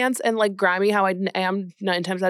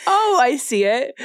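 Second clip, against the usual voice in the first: laughing fake agreement; 4 semitones higher, 4 dB louder, slower than usual. Faking recognition of Meadow Soprano.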